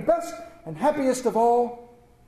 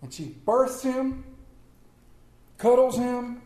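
ordinary talk

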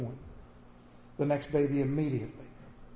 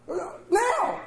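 urgent shout. Retold or imitated. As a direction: yell it much higher, desperate. Demanding help at hospital.

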